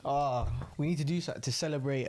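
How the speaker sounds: ordinary talk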